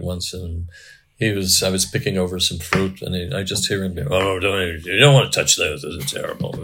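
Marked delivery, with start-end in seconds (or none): 4.1-6.6 lightly voiced gruff frontier man giving advice; Daniel Plainview voice. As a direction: slip into the character's voice, a hint of menace. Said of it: "it's just the voice".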